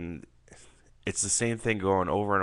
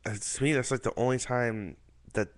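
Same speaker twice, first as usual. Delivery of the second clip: ordinary talk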